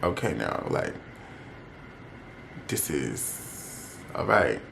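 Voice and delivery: low, soft, drawn-out reluctance; uneasy compliance. Retold or imitated. Going along with his instruction, retold low and uneasy. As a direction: drop it low and quiet, giving in uneasily.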